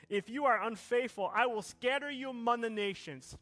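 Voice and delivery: loud, high, building sermon delivery; full-force preaching. Acted. Pastor preaching to the church, loud and rising. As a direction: preach it out over the music to the congregation.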